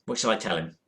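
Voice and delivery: ordinary talk, nothing marked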